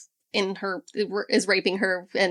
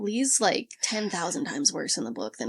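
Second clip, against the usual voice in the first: whispered; 2 semitones lower, 6 dB quieter than usual. Real, not acted. Whispered remark.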